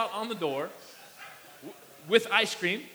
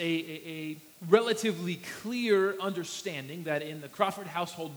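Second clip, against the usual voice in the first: usual speaking voice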